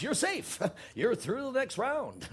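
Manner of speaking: high, drawn-out announcement of who is through; game-show reprieve. Acted. Boss telling staff they are safe, game-show style. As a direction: announce it like a talent-show result, high and slow.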